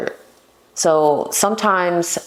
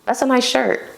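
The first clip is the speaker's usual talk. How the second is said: flirty compliment; 7 semitones higher than usual. Shown as a demonstration. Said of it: "you'd be like".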